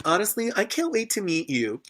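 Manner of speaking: put-on high, sweet child's voice; lightly voiced child. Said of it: "She's like".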